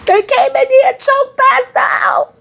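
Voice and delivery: high-pitched shocked yell; shocked shout. Real, not acted. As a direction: scream out the shock. Shocked at a character's death.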